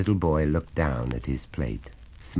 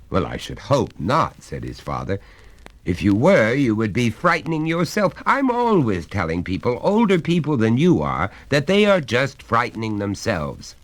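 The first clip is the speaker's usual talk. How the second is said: higher, louder jovial father voice; a hearty father coaxing his son; genial father; 9 semitones higher, 8 dB louder than usual. Acted. Father explains.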